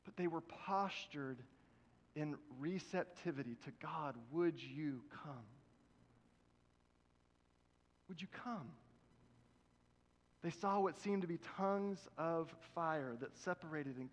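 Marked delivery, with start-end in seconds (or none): 4.3-8.8 soft, slow, prayerful asking; hushed plea. Pretend. Waiting disciples quietly pleading for God to come. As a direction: breathe it out as a longing prayer.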